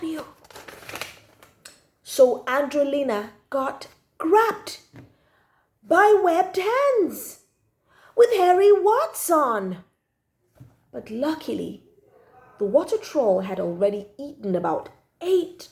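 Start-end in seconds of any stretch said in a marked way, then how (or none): 5.8-9.8 high, loud and slow, drawn out; slow spooky emphasis. A narrator's reading. Child narrator dwelling on the troll's hands, slow and loud. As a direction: stretch each word to spook the listener.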